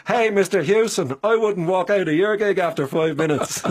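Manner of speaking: put-on a playful Dublin accent heckle across the bar. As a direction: call it out cheekily in your best Dublin accent. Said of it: "with my best Dublin accent".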